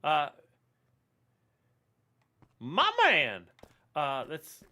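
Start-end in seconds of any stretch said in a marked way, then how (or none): none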